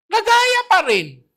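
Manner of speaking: high, slow and loud on "Nagaya"; emphatic. Acted. Preacher telling the congregation the magicians copied it again, high and slow emphasis.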